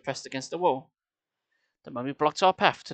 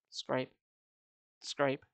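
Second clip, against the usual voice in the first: soft sound effect; 9 dB quieter than usual. A narrator's reading. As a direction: breathe it out quietly. Sound effect read softly.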